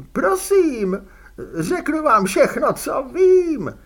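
high, loud desperate begging; panicked pleading. A narrator's reading. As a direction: beg for mercy, terrified.

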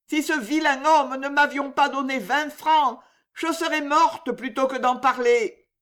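high, whining peasant woman; pleading old woman. A narrator's reading. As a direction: beg out of trouble.

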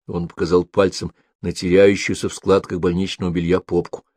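ordinary delivery, nothing marked

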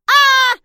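put-on very high, loud child's shriek; child's scream. A narrator's reading. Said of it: "раздался детский крик". (пронзительный детский крик)

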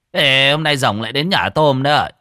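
loud, cheerful calling out; hearty teasing call. Acted. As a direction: call out jokingly to a friend. Joking greeting at the door.

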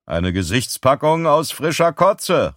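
high, gleeful announcement; calling out. Acted. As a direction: call it out like a mocking sales pitch. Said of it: "rief er".

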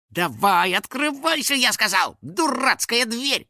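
high, cartoonish, petulant ordering; exasperated demand. A narrator's reading. House spirit ordering a stubborn door open, exasperated demand. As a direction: boss it about in a high voice. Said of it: "попробовал договориться со стеной".

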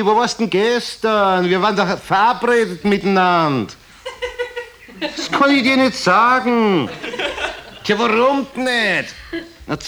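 high, put-on dandy voices trading lines; silly Viennese toff voices. Bobby and Mucki chat at the opera in high, giggly toff voices. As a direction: play both gossiping dandies high and giddy.

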